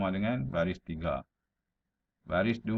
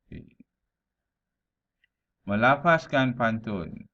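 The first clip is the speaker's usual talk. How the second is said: ordinary talk, nothing marked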